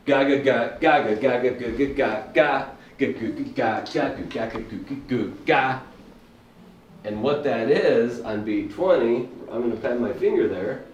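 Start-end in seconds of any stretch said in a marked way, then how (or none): 0.1-5.8 beatbox the pattern quickly; vocal drum pattern. Shown as a demonstration. Teacher voicing pattern twenty for students, rapid drum syllables. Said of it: "If you take pattern twenty".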